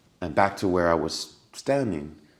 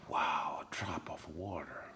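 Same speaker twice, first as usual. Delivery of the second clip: awed whisper; 3 semitones lower, 14 dB quieter, slower than usual. Retold or imitated. Awed at a water drop.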